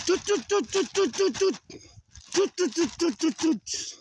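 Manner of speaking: high, rhythmic toot-toot call; high tooting noise. Pretend. Walker toots at the animals, high tooting.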